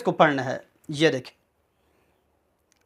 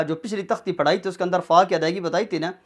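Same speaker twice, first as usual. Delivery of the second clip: ordinary delivery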